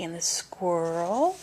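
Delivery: ordinary delivery, nothing marked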